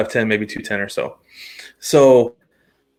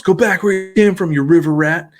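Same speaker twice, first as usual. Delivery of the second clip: higher, louder taunting yell; mock yell; 7 semitones higher, 5 dB louder than usual. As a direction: jeer at the rival kid.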